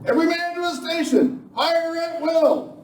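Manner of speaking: urgent battle alarm. Acted. Defender warning his men as Sadie charges, urgent. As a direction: bark out orders under attack.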